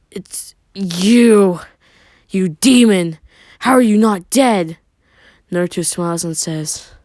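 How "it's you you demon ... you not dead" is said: loud, high shocked cry; stunned loud accusation; shocked outburst. A narrator's reading. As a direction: shout it out in disbelief. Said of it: "staring in shock".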